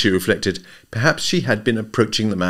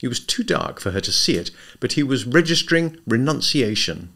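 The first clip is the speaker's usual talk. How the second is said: ordinary delivery, nothing marked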